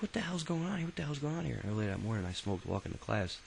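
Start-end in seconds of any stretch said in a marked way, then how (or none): 0.0-1.6 high, baffled repeat; bewildered. Retold or imitated. Student baffled as police storm the classroom, high and bewildered. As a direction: ask it twice in mock disbelief. Confused during drug raid.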